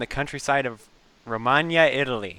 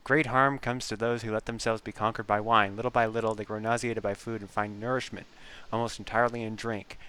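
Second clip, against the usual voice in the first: put-on softer, measured reading of an old text; hushed, solemn reading of a quote; 5 dB quieter than usual. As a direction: read the epigraph quietly and gravely. Reads in-game quote aloud.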